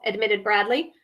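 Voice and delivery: ordinary delivery, nothing marked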